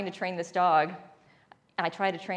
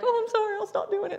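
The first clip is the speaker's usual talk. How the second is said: much higher, meek apologetic dog voice; sorry dog; 12 semitones higher than usual. Retold or imitated. Other dogs voiced by owner, meekly apologizing. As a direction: squeak an apology like a good dog. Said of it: "would be like".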